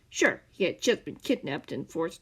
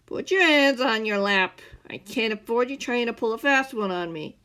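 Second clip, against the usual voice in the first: louder stern order; commanding; 4 semitones higher, 8 dB louder than usual. Acted. The man ordering Scar to keep still, loud and stern. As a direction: bark the order at the captive.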